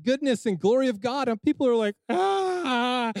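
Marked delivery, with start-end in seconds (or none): 2.1-3.1 a high mock crying wail; mock sobbing wail. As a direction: imitate people bursting into tears, hammed-up. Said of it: "and just crying".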